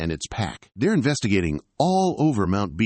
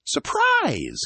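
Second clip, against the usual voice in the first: spring the surprise on her; shouted surprise. Acted. Brother springing a surprise party on the girl, shouting. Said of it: "shouts in surprise".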